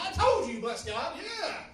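very loud, high triumphant boast; cocky Mister Faith. Retold or imitated. Preacher mocking himself as Mister Faith, triumphant shouting. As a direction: crow it, mock-confident. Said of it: "now I'm Mister Faith".

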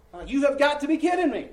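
loud, high cry of disbelief; you can't be serious, shouted high; exasperated outburst. Real, not acted. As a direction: throw up your hands in disbelief. Exasperated at another breakdown.

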